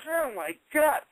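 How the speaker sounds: stunned at the cowardice; disbelief. Retold or imitated.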